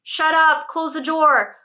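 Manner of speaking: put-on teen shouting at the parent to go away; mock teen scream. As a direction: snap it like a kid fed up with the knocking. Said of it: "screaming at you saying".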